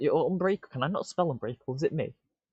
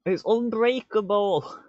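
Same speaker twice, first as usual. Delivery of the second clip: higher and louder, drawn out slowly in disbelief; amazed; 8 semitones higher, 5 dB louder than usual. Real, not acted. Streamer seeing his block survive, slow amazed higher exclaim. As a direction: stretch it out, stunned it actually worked.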